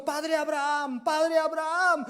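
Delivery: high, loud, slow repeated call; crying out loud. Acted. Rich man calls up to Abraham from torment, loud and slow. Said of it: "alzó su voz tan fuerte".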